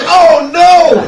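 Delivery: high, loud exclamation of dismay; mock horrified cry. Acting friend's horrified reaction.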